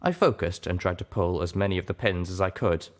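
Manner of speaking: ordinary delivery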